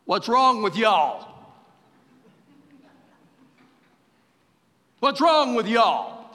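a light West Texas drawl. Acted. Preacher voices Jesus chiding the disciples, light West Texas drawl. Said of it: "Jesus says in his West Texas drawl".

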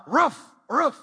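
loud, high pretend woofs; dog bark imitation. Dad answering toddler's animal quiz, playful barking.

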